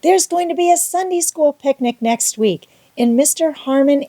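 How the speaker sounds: put-on high, eager child's voice; excited girl. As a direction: bubble over with excitement. Anne excitedly begging about picnic.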